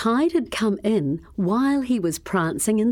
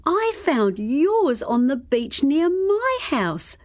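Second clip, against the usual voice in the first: put-on higher, girl's voice; girl's line; 7 semitones higher than usual. A narrator's reading. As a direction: answer as the girl, higher. Repeated lines, crocodile vs girl.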